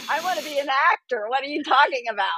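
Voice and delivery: high, fast, laughing protest; rapid, high-pitched incredulous retort; mock exasperation. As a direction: blurt it out in amused disbelief. Acts out her exasperated interview answer.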